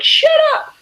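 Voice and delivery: high yelled shut up; angry shout. Retold or imitated. Bullied kid yelling back at the bully, loud high shout. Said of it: "and you're like".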